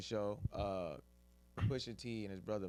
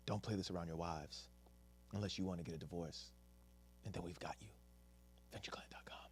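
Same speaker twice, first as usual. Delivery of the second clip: soft, sultry ad read fading to a whisper; seductive ad voice; 3 semitones lower, 8 dB quieter than usual. Shown as a demonstration. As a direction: purr it to the listener like a come-on. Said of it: "This is my seductive ad voice".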